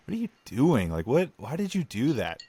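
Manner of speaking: high-pitched, incredulous questioning; baffled disbelief. Acted. Acting out baffled reaction to youngsters.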